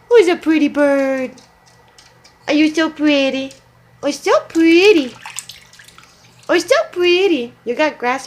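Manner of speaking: high, loud cooing. Real, not acted. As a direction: praise the bird in a high sweet voice. Cooing at the goose.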